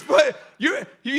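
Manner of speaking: ordinary delivery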